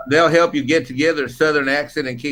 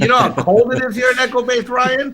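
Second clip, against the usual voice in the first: high whiny complaint; mock complaint; 8 semitones higher, 4 dB louder than usual. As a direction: whine about the cold. Joking complaint.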